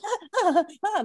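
high-pitched mock "ah" gasps; imitated overwhelmed gasps. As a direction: imitate kids overcome all at once. Imitates overwhelmed returning children.

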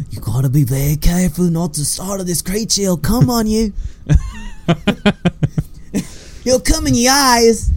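lightly drawled Aussie-turned-Southern wildlife host, rising high near the end; a hint of a hushed croc hunter, sliding into Southern; Steve Irwin impression. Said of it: "I went so Southern so fast".

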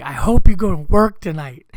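put-on sulky kids. Kids mad at dad, loud spiteful jab. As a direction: fling it at him to hurt.

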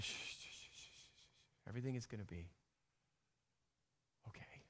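put-on whispered shushing like a mother to a hurt child; mother soothing her hurt baby in a soft whisper; soothing hush. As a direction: hush and calm a crying baby. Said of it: "mommy tells her baby".

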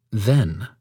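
Then said alone, stressed, falling; emphasized then. Shown as a demonstration. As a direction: set Then apart with weight. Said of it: "The next emphatic word would be then".

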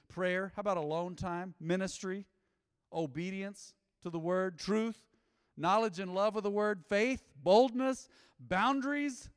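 from steady to full-force preaching; building fervor. Real, not acted.

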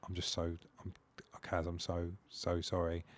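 soft, low, sheepish mumbled sorry; sheepish apology. Retold or imitated. Hungover boyfriend apologising to girlfriend's mum, sheepish. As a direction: apologise quietly, tail between your legs. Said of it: "I was so sheepish".

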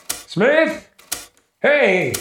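high, loud calls up the stairs; calling out. Acted. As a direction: call up to an empty floor. Calling upstairs for missing men.

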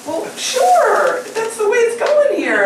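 very high, playful mock reply; giddy agreement. Storyteller agreeing to take the Marine's picture, giddy mock consent. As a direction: go way up, as if swept along by it all.

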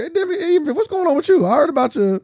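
high, lightly voiced nosy guest; mock curious guest. A guest prodding the host, lightly voiced high. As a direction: poke at him like a nosy guest. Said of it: "guests might even ask you".

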